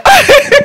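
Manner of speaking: loud high laugh; high burst of laughter. Real, not acted. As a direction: break into laughter. Laughs at own story.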